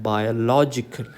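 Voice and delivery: biological said slowly as bio-LO-gical; stress on LO. Shown as a demonstration. Teacher contrasting it with biology, slow bio-LO-gical. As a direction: hit the LO in biological. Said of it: "syllable which is given importance".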